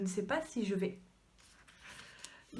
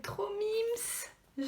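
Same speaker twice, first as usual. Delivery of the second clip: cutesy gush; 11 semitones higher, 4 dB quieter than usual. Real, not acted. Gushing over cute cover.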